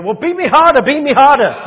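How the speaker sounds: mock the martyr who begs to be beaten harder; mock eager plea. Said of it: "that wasn't his attitude".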